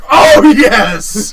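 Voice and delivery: loud, high, laughing "Oh, yes!"; a delighted fan whooping; excited cheer. Retold or imitated. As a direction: relive the thrill of the trailer with a laughing cheer. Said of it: "I was like".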